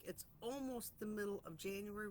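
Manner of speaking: ordinary talk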